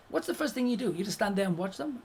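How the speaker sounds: usual speaking voice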